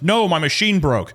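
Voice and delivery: frustrated protest. Retold or imitated. Trenching worker excusing himself to a customer, mock frustrated. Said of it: "the guy is also frustrated".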